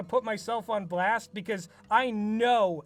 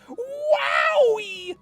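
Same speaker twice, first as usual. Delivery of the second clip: shrieked sky-high and loud; hammed-up squeal of delight; mock thrill; 7 dB louder than usual. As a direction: gush like it's the best twist ever. Mock delight at the cliché plot.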